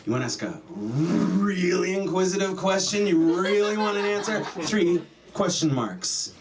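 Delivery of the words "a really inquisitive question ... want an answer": "really" stretched and pitched up; insistent inquisitive emphasis. Shown as a demonstration. Comic explains triple question marks, insistently inquisitive. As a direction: push "really" high and hold it.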